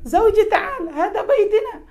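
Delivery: high, playful wife's call with a laugh; wife calling sweetly. Retold or imitated. Wife calls husband to her house. (istri memanggil suami dengan suara tinggi sambil tertawa)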